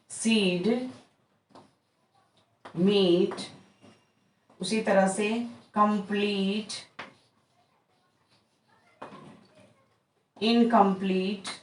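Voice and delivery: सीड, मीट, कंप्लीट held on a long ee; long ee demo. Shown as a demonstration. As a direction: draw out the ee in each word. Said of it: "long sound ई".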